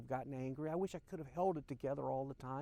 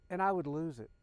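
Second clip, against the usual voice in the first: normal talking voice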